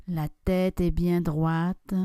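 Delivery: normal talking voice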